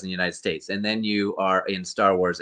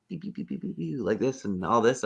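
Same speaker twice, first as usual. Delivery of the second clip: mock computer beeps; 4 semitones higher, 7 dB quieter than usual. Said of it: "And I was like".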